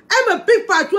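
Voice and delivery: high, loud complaint; indignant outburst. Real, not acted. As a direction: blurt it out, outraged. Complaining, much higher and louder.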